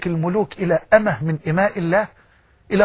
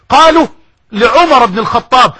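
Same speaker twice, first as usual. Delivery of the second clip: higher and louder proclamation; loud exclaim; 4 semitones higher, 7 dB louder than usual. Real, not acted. Preacher to congregation, loudly exclaiming. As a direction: call it out to the crowd.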